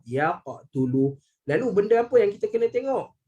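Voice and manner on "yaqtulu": Yaqtulu drawn out very slowly, qaf bounced; slow teacher's model of Yaqtulu; slow recitation demo. Shown as a demonstration. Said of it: "Saya bagi bunyi dulu".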